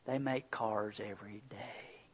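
soft whispered, slow comfort; hushed, slow, tender dad; gentle reassurance. Retold or imitated. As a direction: ease her fear tenderly. Dad comforting crying daughter.

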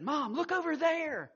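put-on teen girl calling out to her mom, high and loud; teenage daughter calling. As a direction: call out like a daughter spotting the lost child. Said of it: "teenage daughter had said".

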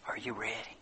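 low, very soft question; hushed appeal. Real, not acted. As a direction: ask the congregation quietly and solemnly. Hushed appeal closing the sermon.

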